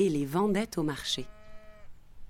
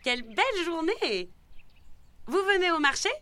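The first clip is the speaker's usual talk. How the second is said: very high-pitched neighbour voice; high, lively village greeting; chatty neighbour; 11 semitones higher than usual. A narrator's reading. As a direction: exclaim it high and sing-out to a passer-by. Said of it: "s'exclama celle-ci".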